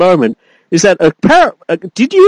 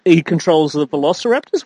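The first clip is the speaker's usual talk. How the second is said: normal talking voice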